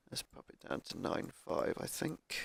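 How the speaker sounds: hoarse, croaky voice. Real, not acted. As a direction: speak through a croaky throat. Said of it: "Sorry about my croaky voice".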